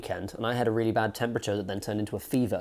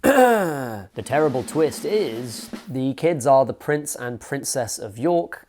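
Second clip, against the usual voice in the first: slow, louder, dramatic trailer announcer read; mock trailer announcer; 5 dB louder, much slower than usual. Reviewer mocking the plot twist, slow dramatic announcer.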